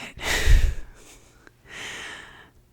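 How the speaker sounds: breathy airy laugh under the breath; whispered giggle. Real, not acted. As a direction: giggle without voicing it. Laughs at her own joke.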